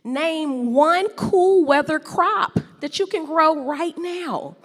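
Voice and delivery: ordinary talk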